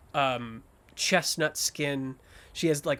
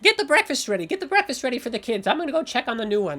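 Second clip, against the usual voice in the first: high, rapid, raised voice; bustling old woman; 8 semitones higher, 5 dB louder, much faster than usual. Acted. Orphanage mother giving breakfast orders, fast high bustling call. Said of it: "hearing the same voice".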